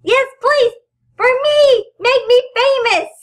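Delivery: high, eager begging; excited pleading. Pretend. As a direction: beg the viewers, bright and high. Begging viewers for fame.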